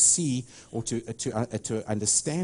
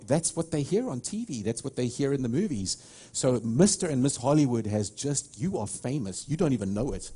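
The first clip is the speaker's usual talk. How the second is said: usual speaking voice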